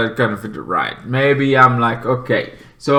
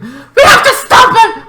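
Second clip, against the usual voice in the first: very high, loud urgent call; hammed-up rallying shout; squeaky cartoon urgency; mock heroic cry; 6 dB louder than usual. Quoting the heroes' rallying cry.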